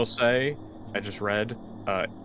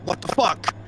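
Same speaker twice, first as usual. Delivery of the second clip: startled yell; 8 semitones higher than usual. Acted. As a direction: yelp it as if jerking back in fright. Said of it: "he falls and yell out".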